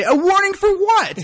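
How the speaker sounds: high, loud, slow disbelieving demand; indignant protest. Retold or imitated. A driver protests the cop's warning, high and loud. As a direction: stretch it out in outraged disbelief.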